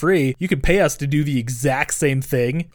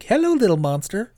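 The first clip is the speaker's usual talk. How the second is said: high, far-off creepy voice; creepy greeting; 7 semitones higher than usual. Retold or imitated. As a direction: coo it like a creep. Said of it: "He's like".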